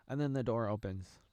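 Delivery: ordinary delivery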